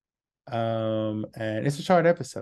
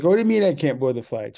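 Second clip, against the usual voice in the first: loud indignant protest; 4 semitones higher, 6 dB louder, faster than usual. Retold or imitated. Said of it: "She was kinda loud".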